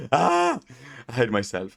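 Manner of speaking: normal talking voice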